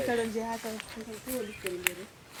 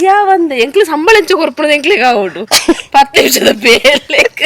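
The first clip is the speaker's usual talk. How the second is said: loud protest; 4 semitones higher, 16 dB louder than usual. Real, not acted. Loud protest about low pay.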